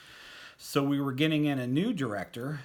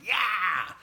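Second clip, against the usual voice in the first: much higher, louder victory "Yeah"; triumphant yell; 10 semitones higher, 8 dB louder than usual. Real, not acted. Boy flashing the rock sign at his friend, triumphant yell. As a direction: cheer the win across the room.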